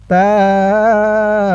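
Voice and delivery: তাম্মা drawn out very slowly; slow recitation. Shown as a demonstration. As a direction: chant তাম্মা long. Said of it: "ভেঙে ভেঙে বলবো". (ধীরে টেনে তাম্মা)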